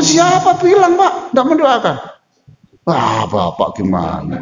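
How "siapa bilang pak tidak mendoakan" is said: indignant retort. Real, not acted. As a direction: snap back in disbelief. Preacher rebuts a questioner.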